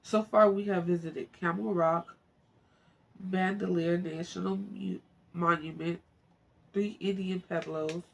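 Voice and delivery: reading the book aloud, lightly paced; story reading. Acted. Viewer reading a travel memoir aloud, plain narration.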